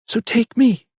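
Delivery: high, desperate plea; pleading. A narrator's reading. Mother pleading with the vicar for her son. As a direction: beg to spare her son. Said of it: "she pleaded".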